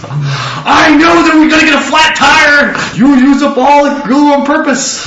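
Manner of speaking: high, loud mock rant about a flat tire; a hammed-up angry outburst; blaming rant. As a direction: shout the blame at everyone.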